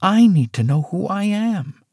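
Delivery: ordinary delivery, nothing marked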